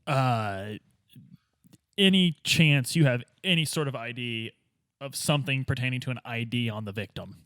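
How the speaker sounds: ordinary talk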